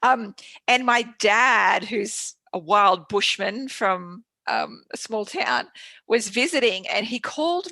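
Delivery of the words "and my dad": ordinary talk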